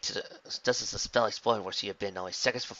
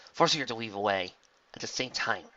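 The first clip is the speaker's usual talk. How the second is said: usual speaking voice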